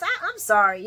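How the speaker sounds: ordinary delivery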